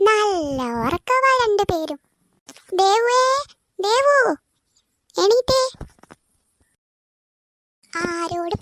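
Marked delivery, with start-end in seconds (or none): 2.8-5.6 calling out. Acted. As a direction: call her out of bed. Mother waking daughter.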